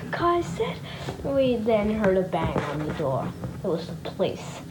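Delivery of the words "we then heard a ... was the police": plain reading voice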